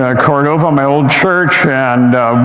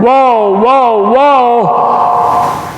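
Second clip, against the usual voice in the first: excited crowd call; 5 semitones higher, much slower than usual. Retold or imitated. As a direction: call out like kids rushing to a fight. Crowd gathering at a fight.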